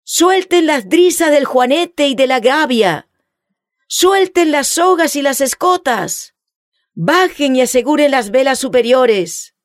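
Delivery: booming captain's orders; stentorian shout. A narrator's reading. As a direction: bellow the orders across the deck. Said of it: "gritó con una voz estentórea".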